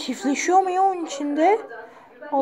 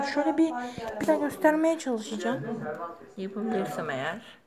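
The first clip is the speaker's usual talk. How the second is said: ordinary talk, nothing marked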